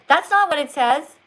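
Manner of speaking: put-on four-year-old correcting. Teacher imitates a child catching a misread word, mildly indignant. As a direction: object loudly like a little kid. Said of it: "a four-year-old will say".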